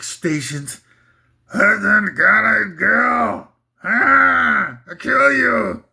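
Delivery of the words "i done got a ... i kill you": slow, high, mangled singer's wail. Retold or imitated. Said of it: "That was my imitation of [name]".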